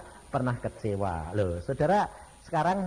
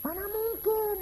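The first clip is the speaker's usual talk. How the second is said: mock scoffer. Voicing scoffing unbelievers.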